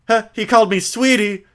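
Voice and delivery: Maya voice. Acted. Streamer voicing Maya to the viewers, girlish and high. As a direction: play an eager young girl.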